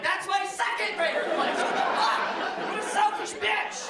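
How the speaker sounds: high, hammed-up offended whine; indignant dad. Dad jealous of baby at wife's breast, high indignant whine. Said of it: "I'm like".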